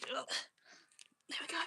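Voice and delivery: whispered aside; whispering. Real, not acted. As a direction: breathe it out under the breath. Unvoiced aside.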